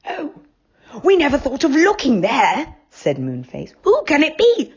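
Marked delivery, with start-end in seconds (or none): 0.0-2.8 higher, lively fairy-tale character; eager friend exclaiming; Moonface voice. A narrator's reading. 3.8-4.7 higher, lively fairy-tale character; eager friend exclaiming; Moonface voice. A narrator's reading.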